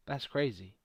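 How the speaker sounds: ordinary delivery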